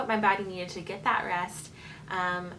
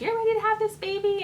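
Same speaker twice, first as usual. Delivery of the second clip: much higher and louder, beaming; excited announcement; 5 dB louder than usual. Retold or imitated. Doctor telling mother it's time, high thrilled call. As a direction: sing it out like big good news. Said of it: "she was like".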